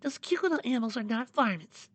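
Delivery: high, soft-voiced indignation; mildly cross in a small, high voice; gentle character getting slightly annoyed; a little mad. A narrator's reading. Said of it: "getting a little mad".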